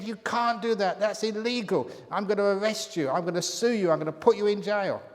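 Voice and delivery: loud, high furious threats; angry rich man. Acted. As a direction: bellow threats at the trespassers. Rich man finds hole dug.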